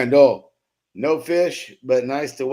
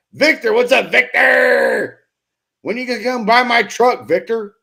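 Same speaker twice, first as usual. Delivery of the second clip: calling out; 8 semitones higher, 6 dB louder than usual. Real, not acted. Calling out to a viewer.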